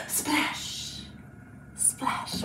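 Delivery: hushed, voiceless splash; whispered splash. Pretend. Teacher dunking a kitty in paint for children, whispered. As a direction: whisper it like a little sound effect.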